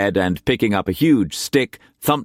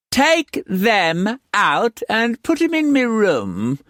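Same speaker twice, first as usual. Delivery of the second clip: high, slow, commanding madam; high, slow madam ordering her girls. A narrator's reading. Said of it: "she ordered".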